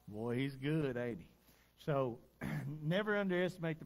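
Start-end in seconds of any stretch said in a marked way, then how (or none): 0.0-1.3 a hint of an old lady with a chuckle; low, gentle mock of his sister's delight; imitating his sister. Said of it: "She's like".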